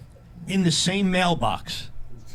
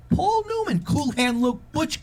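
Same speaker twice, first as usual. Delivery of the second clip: excited star name; 10 semitones higher, slower than usual. Real, not acted.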